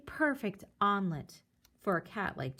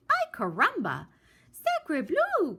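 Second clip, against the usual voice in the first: higher louder exclaim; duck crying out; 8 semitones higher, 4 dB louder than usual. Acted. Duck protests to Jack, loud high exclaim. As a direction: burst out indignantly. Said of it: "cried the duck".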